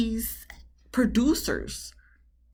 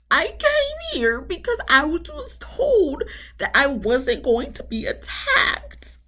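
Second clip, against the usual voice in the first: high, slow mock-crying whine; mock teary whine; 9 semitones higher, slower than usual. Host mocks Alyssa's teary plea, high whiny mock sob. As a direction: parody her teary plea, high and drawn out. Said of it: "started getting teary-eyed and just".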